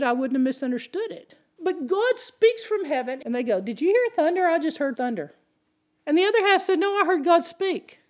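loud, high, driving sermon delivery; preaching at full force. Acted. Pastor preaching to his church about hearing God, full force. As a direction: preach it out to the whole room.

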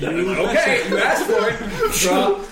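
high laughing taunt. Acted. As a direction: cackle it out, very high. GM voices griffin dropping mage, laughing.

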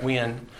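'When' with a breathy puffed wh; lips pursed. Shown as a demonstration. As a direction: start 'When' with a puff of air. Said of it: "a puff of air coming through pursed lips".

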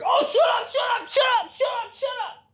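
very high squealing repeated shut up; excited shriek. Retold or imitated. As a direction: squeal with delight at the song. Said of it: "you're like".

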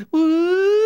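loud, high rising whoop; imitated catcall whoop. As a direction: whoop it out as the pitch slides up. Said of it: "stopped going".

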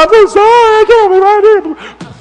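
hammed-up high, loud apologetic wail; Thomas. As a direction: wail the apology in mock anguish. Imagines Thomas wailing an apology.

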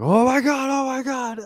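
high-pitched, hammed-up panicked yelp; mock panic. Man imitated with guns aimed at him, mock panic. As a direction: squeal it like a comically terrified man.

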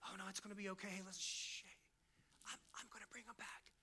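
soft hushing whisper, soothing a mourner; placating hush. Pretend. Jesus hushing grieving Mary, soft placating whisper. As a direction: shush her gently, almost whispered. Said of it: "he doesn't just try to placate her".

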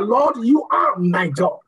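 usual speaking voice

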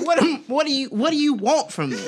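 high, pleading exasperation; what do you want from me, whining; exasperated. Acted. Acting out frustrated partner.

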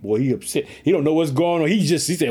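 usual speaking voice

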